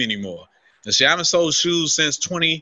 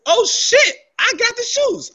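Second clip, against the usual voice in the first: much higher, retold burst of joy; excited; 4 dB louder, slower than usual. Retelling his joy at scoring the sneakers, excited. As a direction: squeal it like the checkout just went through. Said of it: "I'm excited".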